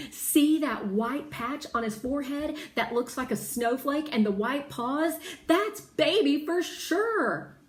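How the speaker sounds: put-on child certain and excited; eager insistence. A narrator's reading. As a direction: convince Mama it's her dog. Insisting it is the dog.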